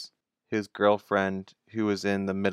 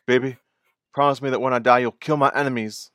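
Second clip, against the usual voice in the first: dramatic dying plea, higher and louder; overacted death scene; 4 semitones higher, 6 dB louder than usual. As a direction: ham up a last dying wish. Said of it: "I don't know why I talked like that".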